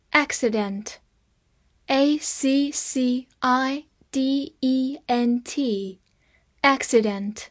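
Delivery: letters one by one, very slow; spelled out slowly. Shown as a demonstration. Teacher spelling the word for students, slowly. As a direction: spell each letter carefully. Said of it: "spelling out letters".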